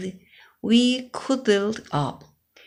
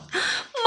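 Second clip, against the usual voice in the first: sharp gasp; 6 dB quieter than usual. Acted. Biscuit breaks.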